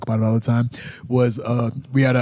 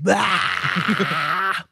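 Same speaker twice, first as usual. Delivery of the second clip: mock yell; 6 semitones higher than usual. Partner yelling ringside.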